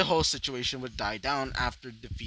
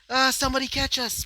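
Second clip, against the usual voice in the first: mock scream; 10 semitones higher than usual. Two girls falling from the sky, high panicked call for help. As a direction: yelp it out while falling. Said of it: "he would scream out".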